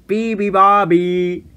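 loud, slow bellowing chant. A narrator's reading. Bears bellowing the song at the bird, loud. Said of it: "bellowed the bears".

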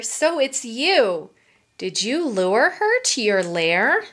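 ordinary delivery

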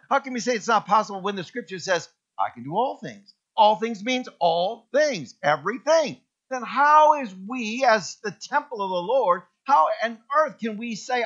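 loud, high preaching; full-force preaching. Acted. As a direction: proclaim it to the whole church.

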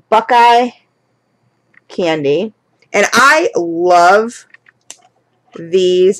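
usual speaking voice

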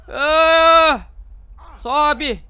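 loud high scream then urgent order. Real, not acted. Gamer screaming while climbing the building, loud scream.

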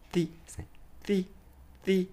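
unvoiced T for TH; a soft breath of a T; whispered T. Shown as a demonstration. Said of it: "ティーエイチなんで".

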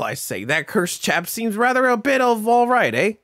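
mock British gentleman's accent; put-on British accent. Game character commenting on cursed chap, mock British accent. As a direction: play it as a hammy British chap. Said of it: "Why did I give you an accent?".

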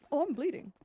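normal talking voice

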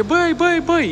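high-pitched mock yell of alarm; retold yell. Friend yells at the reversing driver, high mock shout. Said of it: "țipă la domnișoara și zice".

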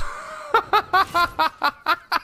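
long, high rapid cackle; helpless real laughing fit; laughing hard. Real, not acted. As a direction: crack up at your own joke. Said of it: "I don't know why I'm laughing so hard".

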